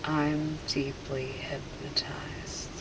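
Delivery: slow, whispered repetition; entranced echo. Real, not acted. Subject repeating the hypnotist's suggestion, entranced whisper. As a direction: whisper it back as if in a trance.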